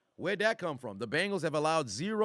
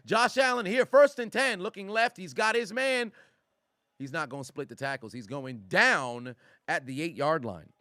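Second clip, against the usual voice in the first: higher, louder mock announcer call; mock play-by-play; 4 semitones higher, 4 dB louder than usual. Streamer calling the game for chat, mock announcer. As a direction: call the play like a hyped broadcaster.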